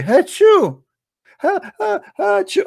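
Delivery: high, hammed-up achoo; loud comic pretend sneezing; fake sneeze. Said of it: "Let us sneeze so Allah get happy".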